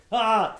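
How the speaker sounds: high, loud cry of reaction; imitated loud "ah". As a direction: burst out with a mock "ah". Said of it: "he just kinda like that".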